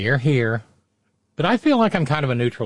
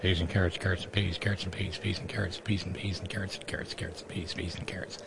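low murmured chant fading to a whisper; crowd murmur; 5 semitones lower, 12 dB quieter, faster than usual. Shown as a demonstration. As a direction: mumble it like a distant crowd. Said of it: "That's how you make a crowd noise".

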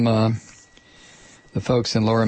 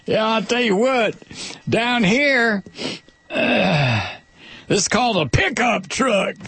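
mock lecture; 8 semitones higher, slower than usual. Host jokes back at the listener.

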